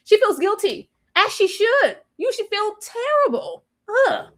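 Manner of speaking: high, mock-exasperated scold; hammed-up exasperated therapist. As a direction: tell her off, high and sharp.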